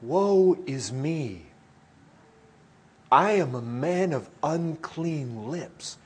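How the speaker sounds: slow, weighty scripture quote; solemn lament. Acted. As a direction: draw out the lament slowly. Quoting Isaiah's lament.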